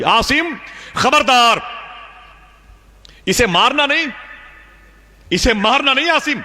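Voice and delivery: high, ringing call of warning; warning voice from nowhere. Retold or imitated. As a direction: call out a stern warning from afar. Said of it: "میرے کان میں آواز آئی". (غیبی آواز کی بلند تنبیہ)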